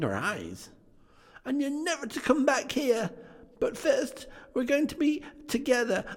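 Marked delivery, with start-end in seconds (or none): 1.4-6.0 put-on high, eager child voice; little Fran telling her brother the good news, high and excited; little girl. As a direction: chirp it like an excited little sister. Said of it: "said the child".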